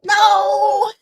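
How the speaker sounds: imitating his dramatic loud howl; retold anguished yell. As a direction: wail it like the jilted groom. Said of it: "And then he yells out this".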